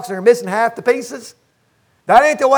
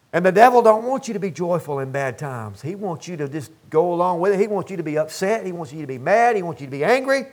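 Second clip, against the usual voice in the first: softer, lower, confiding; hushed warning; 6 semitones lower, 7 dB quieter, faster than usual. Acted. Preacher warning church about devil, hushed and low. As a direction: drop the voice, warn them quietly.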